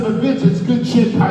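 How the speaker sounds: high, loud enthusiastic sell of the idea; eager pitch. Retold or imitated. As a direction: push the idea eagerly on the boss. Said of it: "So there was my argument".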